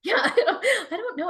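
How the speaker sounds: high, giggling through the words; laughing. Real, not acted. Laughing after cuckoo bananas joke.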